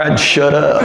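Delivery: ordinary delivery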